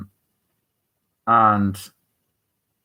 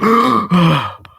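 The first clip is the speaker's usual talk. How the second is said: sharp waking gasp; 7 semitones higher, 7 dB louder than usual. Acted. Lemon wakes up suddenly.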